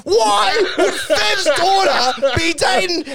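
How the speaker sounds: slow, high yelled disbelief; mock outraged question. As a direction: holler it slowly in hammed-up outrage. Acted quarrel, shouted question.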